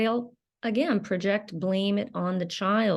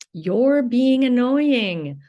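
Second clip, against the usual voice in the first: shaming parent; 4 semitones higher, 6 dB louder, much slower than usual. Retold or imitated. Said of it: "shaming her for being in emotional pain".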